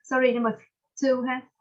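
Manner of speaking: normal talking voice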